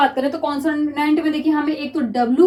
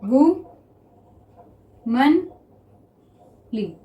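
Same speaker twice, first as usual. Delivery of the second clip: drawn out; much slower than usual. Shown as a demonstration. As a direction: stretch womanly very slowly, syllable by syllable. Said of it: "ठीक, देखिए". (Womanly को बहुत धीरे खींचकर बोलें)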